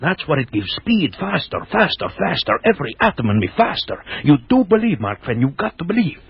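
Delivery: high, frantic mad scientist; manic raving. Acted. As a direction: rave with mounting frenzy. Mad doctor raves about drug.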